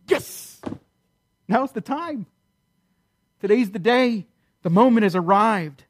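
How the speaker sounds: hissed "yes", then high-pitched eagerness; acted excitement. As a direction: play a thrilled bride whose day has come. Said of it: "She's excited. She's ready".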